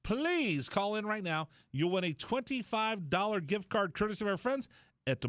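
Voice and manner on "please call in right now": ordinary talk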